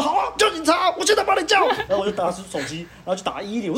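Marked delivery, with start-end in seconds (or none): 0.0-1.7 very high, louder shouting dare; retold furious shout, very high. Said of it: "反正我就暴怒".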